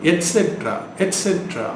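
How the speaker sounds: et cetera as et-SET-ra, high start; t sound. Shown as a demonstration. Teacher modelling the correction, t sound. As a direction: say et cetera with a clear t. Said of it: "டி sound தான் வரணும்".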